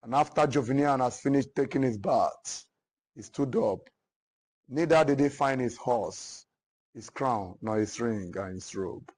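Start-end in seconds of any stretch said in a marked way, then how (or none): none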